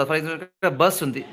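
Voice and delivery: ordinary talk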